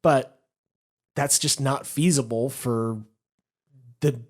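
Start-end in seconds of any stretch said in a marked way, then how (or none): none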